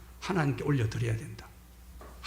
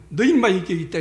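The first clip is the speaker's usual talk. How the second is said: a brief raised, louder telling-off; mock scolding; 5 semitones higher, 7 dB louder than usual. Jesus imagined telling off his doubting disciple, mock scolding. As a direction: scold like a stern teacher, acted. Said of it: "혼을 내거나".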